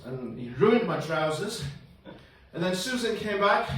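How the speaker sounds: usual speaking voice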